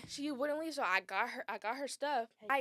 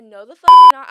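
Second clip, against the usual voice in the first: furious shout; 29 dB louder than usual. Retold or imitated. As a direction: yell it back, loud and hard. Retold confrontation at the door.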